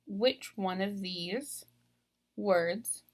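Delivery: usual speaking voice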